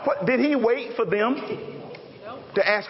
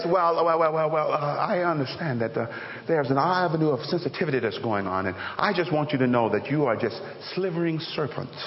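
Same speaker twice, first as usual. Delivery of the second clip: lower, softer, mock-diplomatic hedging; overly polite version; 5 semitones lower, 3 dB quieter than usual. Preacher parodying a gentle John, mock-polite and soft. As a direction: sugarcoat the insult sweetly. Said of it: "he could have said something like this".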